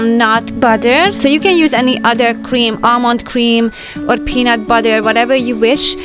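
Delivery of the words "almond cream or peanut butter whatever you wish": ordinary talk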